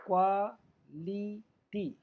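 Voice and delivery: Quality very slowly as क्वा … ली … टी; teacher splitting quality into parts; syllable by syllable. Shown as a demonstration. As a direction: pause after each of three beats. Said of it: "क्वा हो गया".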